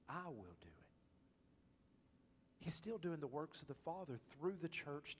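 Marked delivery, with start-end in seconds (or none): 0.1-0.8 soft whisper pressing I; whispered emphasis on I. Shown as a demonstration. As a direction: whisper I will do it, leaning on I. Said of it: "do you notice the emphasis".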